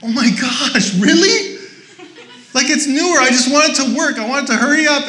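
high, loud mock frustration with laughter; exasperated rant. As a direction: pastor playing his impatient self at the oven, loud and exasperated. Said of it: "And I'm like".

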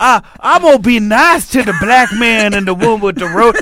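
high, loud Southern caricature; hammed-up movie villain trying to be polite; Percy. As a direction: put on an awkward, overdone niceness. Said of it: "he tries to be nice".

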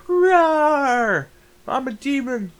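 high, drawn-out rawr, then cutesy boast; playful mock demon. Masked woman teasing a little boy, playful demon. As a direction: play a harmless pretend demon.

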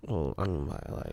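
soft whispered reply; whispering. Real, not acted. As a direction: hush it to a whisper. Whispering beside resting wife.

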